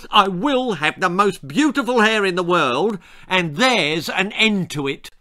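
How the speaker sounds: loud, high-pitched defiant declaration. A narrator's reading. Brat refuses to be dissuaded by parents, defiantly. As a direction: lay it down as final, stressed and insistent.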